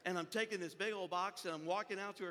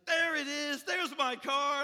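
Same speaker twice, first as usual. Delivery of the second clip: higher, louder cry of discovery; acted excitement; 7 semitones higher, 8 dB louder, slower than usual. Woman finally sees her lost car, acted excitement. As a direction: call out as if suddenly spotting it.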